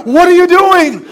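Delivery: husband demanding what his wife is doing; serious demand. Retold or imitated. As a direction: confront her over the sandwich. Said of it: "I was very serious".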